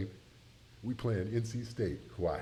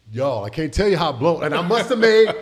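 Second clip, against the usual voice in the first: bursts out laughing mid-sentence, much louder and higher; 8 semitones higher, 10 dB louder than usual. Real, not acted. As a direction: laugh through the embarrassed confession. Embarrassed memory, crowd reacting.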